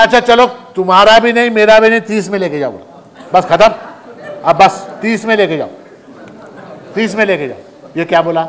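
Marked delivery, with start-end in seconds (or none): 0.0-2.7 higher, louder and fast, irritated; fed-up shopkeeper. Retold or imitated. Shopkeeper to stingy customer, irritated and fast. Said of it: "दुकानदार बेचारा झल्ला गया". 3.3-7.9 irritated final offer. Retold or imitated. Shopkeeper insisting on his last price, irritated. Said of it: "दुकानदार बेचारा झल्ला गया".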